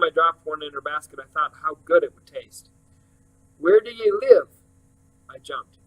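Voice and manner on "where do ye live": put-on high, sudden old woman's question; a high-pitched old woman asking out of nowhere; old lady's voice. A narrator's reading. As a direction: pop the question up high like a nosy old lady. Old lady asks abruptly.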